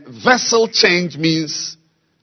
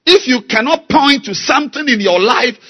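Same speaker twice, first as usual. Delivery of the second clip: rising, louder sermon cadence; fiery preaching; 10 semitones higher, 8 dB louder than usual. Acted. Preacher rebuking Christians who won't change, heated. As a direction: build the call, line by line.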